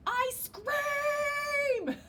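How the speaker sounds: high, loud long call; yelling. Acted. Waffle calls to Ice Cream across recess, high and loud. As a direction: shout it across the playground. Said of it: "yelled Waffle".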